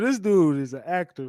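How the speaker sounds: ordinary talk, nothing marked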